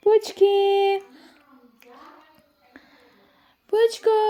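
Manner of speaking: high, drawn-out cooing call. Real, not acted. Adult calling a baby to look, high sing-song call. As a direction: call out pet names high and long.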